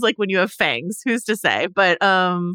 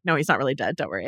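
softer, lower mock comfort; mock reassuring; 7 semitones lower, 5 dB quieter, much faster than usual. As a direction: soothe them like a liar. Mock soothing TV writers.

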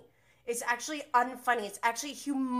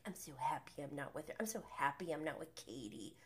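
mocking whisper; 6 semitones lower, 10 dB quieter, faster than usual. Mocking Schwartz's denial.

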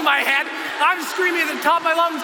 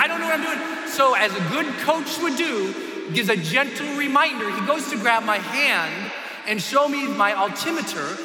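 ordinary talk

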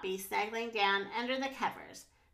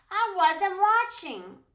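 higher, louder, drawn-out complaint; whiny protest; 8 semitones higher, 7 dB louder, slower than usual. A narrator's reading. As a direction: protest slowly like a cheated child. Max protests losing at cards.

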